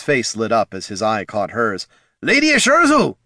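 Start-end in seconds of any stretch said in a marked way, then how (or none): 2.2-3.2 high, loud hail across a crowd; shout. A narrator's reading. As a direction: call out to her over the mob. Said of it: "he shouted".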